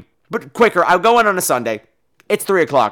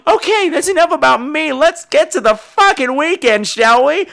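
excited; 10 semitones higher, 4 dB louder than usual. Real, not acted.

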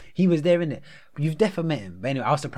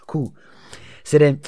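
normal talking voice

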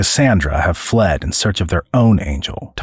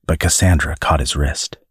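ordinary delivery

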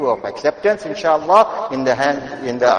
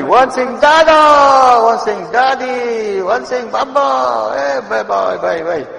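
high, drawn-out imitation of children calling; high mock calls, easing down at the end; clamouring grandchildren; 10 semitones higher, 4 dB louder, slower than usual. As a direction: mimic kids calling over each other, then fade.

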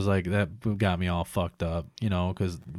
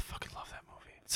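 breathless whisper of love for it; whispered gush; 20 dB quieter, faster than usual. Real, not acted. Telling his friend how much he loves Moana, whispered. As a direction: confide it in a hush.

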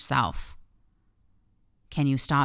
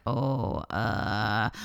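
lower, hesitant mock stammer; awkward, low-voiced mock hesitation; caught out; 5 semitones lower than usual. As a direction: imitate a man stumped by a question. Men caught out by her question.

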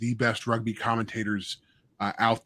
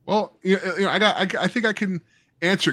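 giggling, pitched up, while talking; real laughter spilling into the words; laughing through words; 9 semitones higher, 5 dB louder than usual. Real, not acted. Host laughs at the guest's kick joke, voice pitched high.

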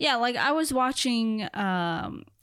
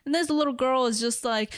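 normal talking voice

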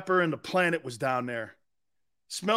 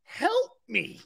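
a touch higher, pleading help me; mock begging for help; mock plea; 6 semitones higher than usual. Begging the laughing hosts, a touch higher and mocking. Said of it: "I feel like you guys are laughing at me".

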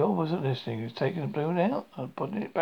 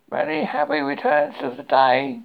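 loud, high birthday call; 8 semitones higher, 8 dB louder than usual. A narrator's reading. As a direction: call it across a river. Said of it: "called out Pooh".

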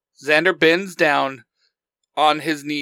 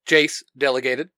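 usual speaking voice

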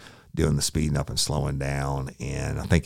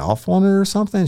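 mocking high voice; 9 dB louder, faster than usual. Mocking the suspect's idea.

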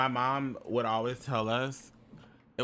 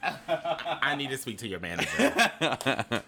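entitled customer; much slower than usual. Pretend. Mock entitled customer, laughing.

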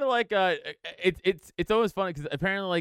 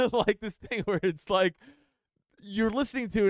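ordinary talk, nothing marked

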